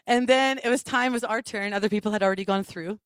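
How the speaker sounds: normal talking voice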